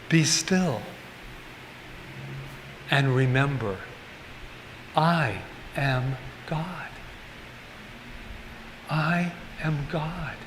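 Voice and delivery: soft, slow meditative mantra; a hushed, drawn-out mantra. Shown as a demonstration. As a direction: murmur it like a prayer, slowly. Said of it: "a beautiful mantra to say".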